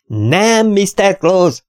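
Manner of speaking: high, projected contradiction; shouting. A narrator's reading. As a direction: call out high to deaf ears. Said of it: "ordítottam". (magas hangon kiáltva)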